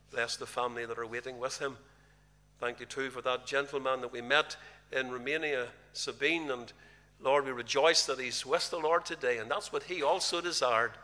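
ordinary delivery

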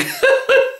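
sudden high-pitched giggle. Real, not acted. Butcher cracking himself up, high squeaky giggle. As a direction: let a squeaky giggle escape. Laughing at own joke.

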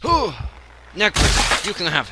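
loud exasperated giving up; loud outburst. Real, not acted. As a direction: shout it out, fed up.

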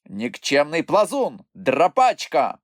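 high, taunting exclamations; shouted insults. A narrator's reading. Moomintroll taunts the poisonous bush, shouted insults. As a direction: hurl names, stretching the last one. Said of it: "вигукував Мумітроль".

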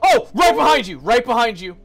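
loud, high urgent warning; alarmed shout. Real, not acted. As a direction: yell out a warning in real panic. Warning teammate of enemy.

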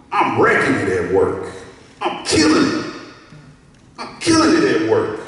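loud, punchy self-praise; boastful. Acted. Preacher acting out a proud worker, loudly boastful.

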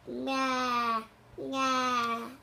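loud goat bleating. Shown as a demonstration. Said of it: "can you make a sound like a goat?".

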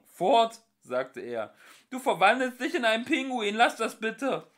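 high, loud, fast plea; pleading shout. Acted. Arthur begging Ford to stop turning penguin, loud. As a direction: beg him to stop.

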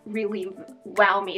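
ordinary talk

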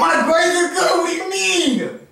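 high, drawn-out defensive outcry; real, high-pitched indignation; indignant protest. Real, not acted. Defending grades in an argument.

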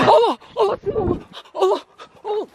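high frightened cries; panicked screaming. Real, not acted. Scared screams in dark park. (korkuyla çığlık)

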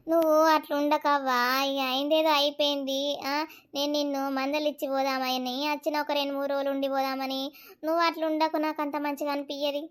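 low, soft, soothing voice; gentle consoling. Acted. Visiting elder asks the mother not to grieve, gently consoling.

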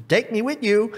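high-pitched frog voice; acted high frog demand. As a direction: pipe up high, insisting on coming along. Frog demands to come along.

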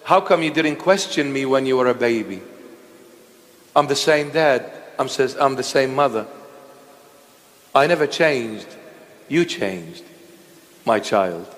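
tender father. Retold or imitated. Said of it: "The father says".

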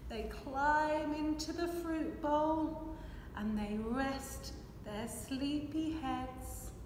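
usual speaking voice

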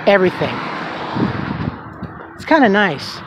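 ordinary talk, nothing marked

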